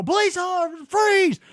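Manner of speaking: high, drawn-out mock cop yell; police ambush shout. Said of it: "the police jump out and they go".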